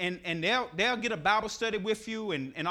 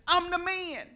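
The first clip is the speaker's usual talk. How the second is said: insisting; 10 semitones higher than usual. Acted. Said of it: "he himself insisted".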